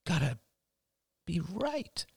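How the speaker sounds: put-on soft, high child's voice; little boy. A narrator's reading. Ben peers into his candy bucket, small boy voice. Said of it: "said Ben, staring into his bucket".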